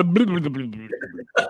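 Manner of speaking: rapid bubbling blub-blub noise; imitated drowning gurgle. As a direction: mimic gurgling underwater while going down. Acting out himself drowning.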